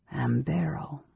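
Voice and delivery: slow unvoiced whisper of the name; lingering. A narrator's reading. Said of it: "said it lingeringly".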